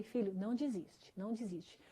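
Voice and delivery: soft, low motherly encouragement; gentle retold plea. Mother consoling her upset son, quiet gentle plea. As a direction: quietly urge him on, voice dropping.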